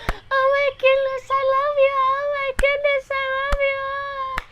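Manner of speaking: very high, held falsetto gush; mock besotted woman squealing adoration; lovestruck swooning. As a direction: swoon in a sing-song, sky-high voice, dragging the last words. Potion makes her swoon over him.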